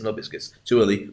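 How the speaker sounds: ordinary talk, nothing marked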